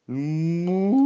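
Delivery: hohes, lautes Kuhmuhen; Muhen. Acted. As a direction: muh wie eine Kuh. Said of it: "el animal contestó".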